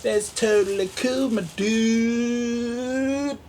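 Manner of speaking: high, laid-back surfer voice; surfer dude. Pretend. Vlogger reacting to a product, high surfer-dude voice. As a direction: stretch dude like a chill surfer.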